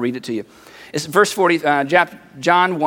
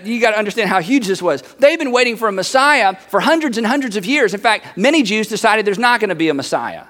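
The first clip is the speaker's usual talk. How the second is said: ordinary delivery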